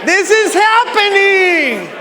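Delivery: rally the crowd to believe it; motivational preaching. Real, not acted. Preaches at full force.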